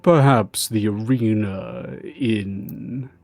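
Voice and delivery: slow, low, scheming lord voice. Acted. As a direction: muse on their fate slowly. Lord answers the guard.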